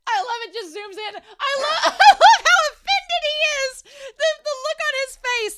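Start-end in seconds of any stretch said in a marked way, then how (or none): 1.6-5.6 loud, high laughing shout. Real, not acted. Reacting to Shin's offended face, real laughing shout. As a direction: crack up while yelling it.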